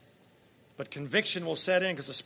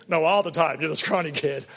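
laughing; 2 semitones lower, 4 dB louder than usual. Real, not acted. Laughs at a friend's joke.

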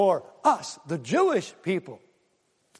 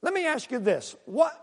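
ordinary talk

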